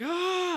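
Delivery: high-pitched mock yell "ah"; sudden high cry, acted out; imitated Titan cry. Titan getting stressed, retold.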